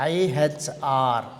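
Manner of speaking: IHR spelled out slowly, letter by letter; slow spelling. Shown as a demonstration. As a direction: read IHR letter by letter, drawn out. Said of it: "ఏం పలుకుతాము".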